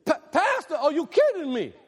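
pastor hamming up a gushing reunion with a church member; mock overexcited greeting. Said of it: "What would happen?".

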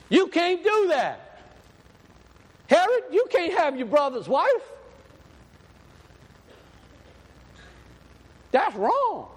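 high, forceful prophet's rebuke; loud rebuke. Acted. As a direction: confront the king at full voice. Acts John rebuking Herod.

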